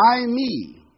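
"I, me" said as one Greek word, eye-MEE; careful Greek pronunciation. Shown as a demonstration. Preacher shows listeners the Greek word, carefully pronounced "I, me". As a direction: carefully sound out "I, me" with a high start. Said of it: "I hope I pronounced this correctly".

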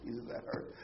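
a quick hushed aside; murmured remark; whispered aside. Real, not acted. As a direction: whisper it under your breath.

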